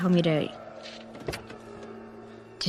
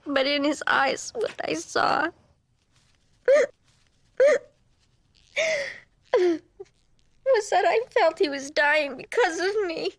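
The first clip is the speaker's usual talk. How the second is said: crying; 12 semitones higher, slower than usual. Real, not acted. Woman recalling the dying man's eyes, crying. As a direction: voice rising high and breaking into tears, slowed right down.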